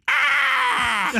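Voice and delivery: very high shouted bird shriek; sudden squawk; parrot screech. Retold or imitated. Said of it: "that African gray went".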